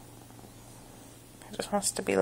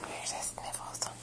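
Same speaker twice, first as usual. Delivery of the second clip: soft hide-and-seek whisper; 11 dB quieter than usual. Pretend. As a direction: hush it like a secret. Peekaboo whisper.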